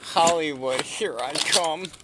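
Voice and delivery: slow, high, loud showman call; excited announcement. Pretend. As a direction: proclaim it like a star. Joking about being famous.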